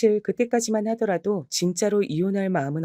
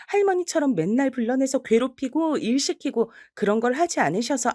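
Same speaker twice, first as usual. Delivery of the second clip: higher, cutting and cheeky; pointed sarcastic retort; 7 semitones higher than usual. Acted. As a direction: needle her with each word. Granddaughter retorts to grandmother.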